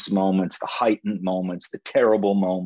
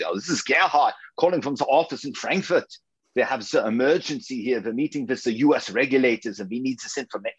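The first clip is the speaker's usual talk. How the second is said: put-on German accent; 4 semitones higher than usual. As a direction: fake German accent, pitched higher, urgent. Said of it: "I put on this German accent".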